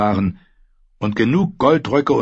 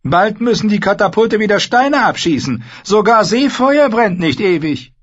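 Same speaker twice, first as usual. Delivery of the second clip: high, loud hurrying order; urging command; 11 semitones higher, 5 dB louder than usual. Acted. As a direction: press on him urgently. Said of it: "sagte er zu Joffrey". (drängender Befehl)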